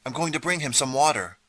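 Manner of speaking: urgent calling out. A narrator's reading. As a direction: call it out to the room. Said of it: "Greta called".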